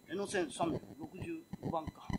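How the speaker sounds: slow, breathless whisper of disbelief; whispered shock; stunned whisper. Acted. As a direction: barely breathe the number out. Man checks his ticket in disbelief.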